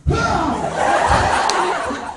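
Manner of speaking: high loud mock power-up shout; power-up yell. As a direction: yell like an anime hero. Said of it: "I kept wanting to go".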